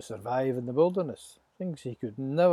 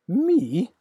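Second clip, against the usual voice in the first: high, loud disbelieving cry; astonished; 10 semitones higher, 10 dB louder than usual. Acted. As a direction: Moses stunned at being chosen. Moses astonished.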